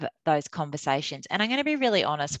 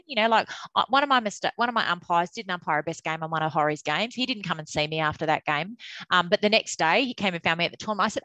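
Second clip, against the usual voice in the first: usual speaking voice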